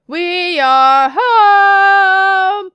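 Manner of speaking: loud, long-held high notes; drawn-out playful homecoming bellow; sing-song arrival call. Pretend. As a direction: holler it, stretching every word.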